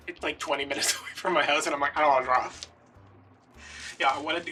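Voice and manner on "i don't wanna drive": whiny. Retold or imitated. Repeats refusal, louder put-on voice.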